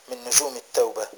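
usual speaking voice

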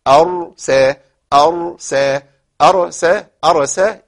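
أرسى with the R held short and still; correct short R. Shown as a demonstration. Teacher drilling the class on a short still R. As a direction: stop on the R of أرسى briefly, no trill. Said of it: "قف على الراء بتسكين الراء".

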